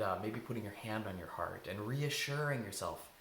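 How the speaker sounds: usual speaking voice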